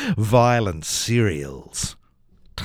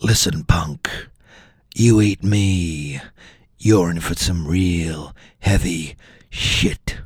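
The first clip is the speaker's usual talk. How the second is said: tough-guy All-Bran; 10 semitones lower than usual. Acted. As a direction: growl it like a mob heavy. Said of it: "the All-Bran spoke".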